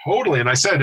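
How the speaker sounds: normal talking voice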